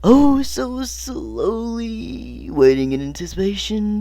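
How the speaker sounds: very slow, high-pitched dramatic stretch; drawn-out agony. A narrator's reading. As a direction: drag out every word in exaggerated suspense. Dramatic narration of agonizing slow pan.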